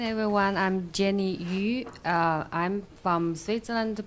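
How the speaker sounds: ordinary delivery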